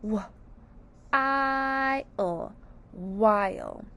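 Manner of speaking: slow, letter by letter then blend; sounding out letters. Shown as a demonstration. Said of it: "Let's sound out while".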